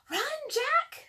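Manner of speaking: put-on high, loud excited girl's call; Annie calling. A narrator's reading. Annie urging her brother to flee, high excited call. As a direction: yell it like a thrilled little sister. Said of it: "said Annie".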